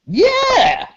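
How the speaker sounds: very high drawn-out squeal; high crazed agreement; eager crackhead. Acted. Crackhead accepts the deal.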